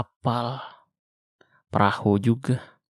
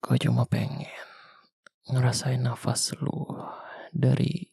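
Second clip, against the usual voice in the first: breathy close whisper, forehead to forehead; intimate whisper; 2 semitones higher, 5 dB quieter than usual. Acted. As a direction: whisper it right against her face. Foreheads together, wants to feel breath.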